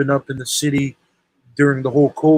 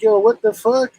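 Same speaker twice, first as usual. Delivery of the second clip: higher-pitched incredulous retort; retold indignation; 7 semitones higher than usual. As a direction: replay your disbelief at being stood up. Retells his indignant reply to caller.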